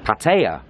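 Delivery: PATTAYA as pat-AY-uh, TAY in the middle; pronunciation two. Shown as a demonstration. Vlogger showing the vloggers' way, Pattaya as pat-AY-uh. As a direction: say Pattaya with a middle that rhymes with day. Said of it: "that's pronunciation number two".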